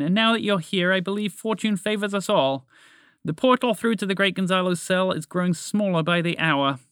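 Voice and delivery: high, formal toucan voice; deferential toucan. Acted. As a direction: appeal respectfully to a great dragon.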